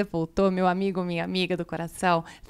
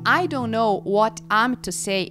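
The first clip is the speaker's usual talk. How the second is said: slow spoken demo; slower than usual. Shown as a demonstration. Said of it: "canto essa estrofe bem devagarzinho".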